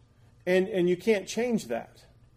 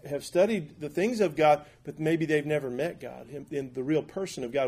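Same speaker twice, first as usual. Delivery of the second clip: normal talking voice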